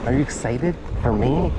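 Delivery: ordinary talk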